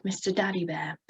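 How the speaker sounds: normal talking voice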